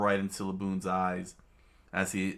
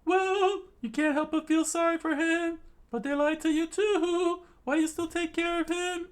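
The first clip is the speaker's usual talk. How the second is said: very high squeaky falsetto voice; piercingly high, put-on cartoon voice; high-pitched character voice; 4 dB louder than usual. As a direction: squeak it out far above your own pitch. Acting out a character's line.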